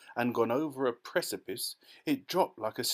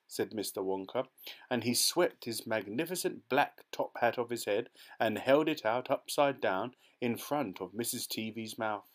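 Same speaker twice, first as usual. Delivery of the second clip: plain reading voice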